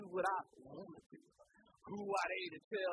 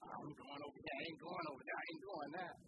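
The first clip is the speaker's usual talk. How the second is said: whiny refusal; 9 semitones higher, 7 dB quieter than usual. Retold or imitated. Churchgoer refusing God.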